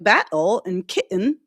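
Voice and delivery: battle and kitten with glottal stops for the T's; dropped T's. Shown as a demonstration. Imitating UK speakers, battle and kitten without T's. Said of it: "drops the T's in".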